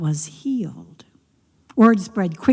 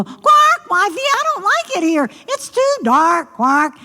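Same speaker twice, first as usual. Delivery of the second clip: high frog whine, loud first croak; high-pitched, peevish frog; complaining frog; 11 semitones higher, 4 dB louder than usual. Acted. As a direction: fuss like a frog afraid of missing comfort. Frog complains about the dark.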